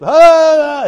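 sudden loud high "Ah!" yelled out; demo cry out. Shown as a demonstration. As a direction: let out a raw cry to show it. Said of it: "Couldn't he just".

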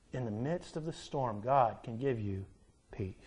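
low, soft, comforting appeal; hushed low voice, letting each phrase settle between pauses; tender reassurance. Real, not acted. Preacher telling the burdened that God gives peace, tenderly reassuring.

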